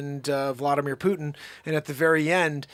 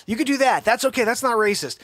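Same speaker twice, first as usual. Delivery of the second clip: usual speaking voice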